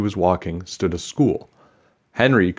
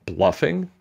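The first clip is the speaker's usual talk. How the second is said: incredulous echo; 8 semitones higher than usual. Acted. Henry repeats the word indignantly.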